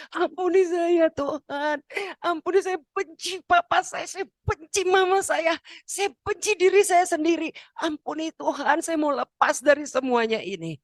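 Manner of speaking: high-pitched, acted wailing plea for forgiveness; tearful confession. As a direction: re-enact crying out to God, pitch way up. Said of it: "sampai saya nangis". (ratapan tangis memohon ampun, nada tinggi)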